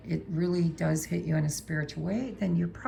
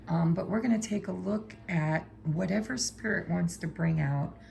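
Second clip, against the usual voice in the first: usual speaking voice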